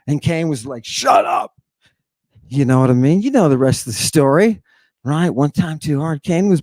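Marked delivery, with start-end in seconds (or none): 0.9-1.5 harsh, voiceless mock shout. Cain silences his brother, harsh mock shout. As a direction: cut him off with a rough, throaty yell.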